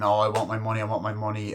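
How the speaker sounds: normal talking voice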